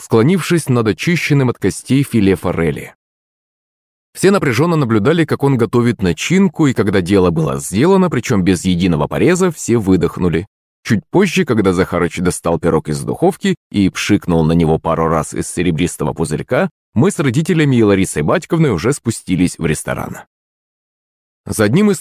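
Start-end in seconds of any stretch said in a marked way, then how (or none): none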